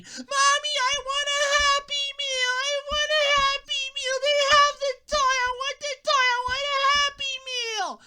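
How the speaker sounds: put-on very high, loud kid's whine; screaming kid. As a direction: wail it like a kid demanding a Happy Meal. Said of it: "children screaming".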